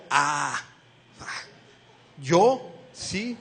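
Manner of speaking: halting, disbelieving stammer; a touch of stunned disbelief; stammering surprise. Acted. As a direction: stumble through it, as if you can't believe the words. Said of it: "Gedeón se sorprendió".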